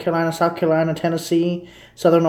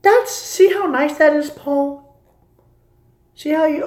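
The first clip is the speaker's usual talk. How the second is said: excited; 12 semitones higher, 4 dB louder than usual. Real, not acted. Showing off the view.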